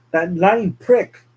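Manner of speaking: slow, loud, furious curse; angry outburst. Acted. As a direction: spit it out in rage. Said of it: "William gets angry".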